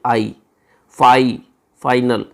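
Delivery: फाइनल with the "eye" sound split out as आई, फाई; vowel sound demo. Shown as a demonstration. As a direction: isolate the vowel then build the word. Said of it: "वो आई की आ रही है".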